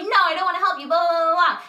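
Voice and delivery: half-hearted retold screaming; mock yell. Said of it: "screaming at this girl".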